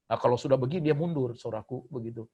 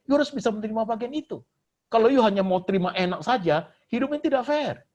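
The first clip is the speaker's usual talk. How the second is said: higher and louder, pounding the pulpit with each clause; fiery preaching; 10 semitones higher, 5 dB louder than usual. Acted. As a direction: preach it full force at the congregation. Preaching at full force.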